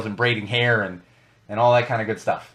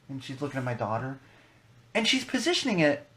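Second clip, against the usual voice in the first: ordinary delivery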